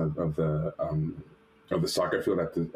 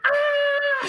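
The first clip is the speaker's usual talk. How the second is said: loud, very high joyful scream; long high-pitched phone scream; mom screaming; 6 dB louder than usual. Retold or imitated. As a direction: shriek like an overjoyed mom. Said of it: "She's screaming on the phone".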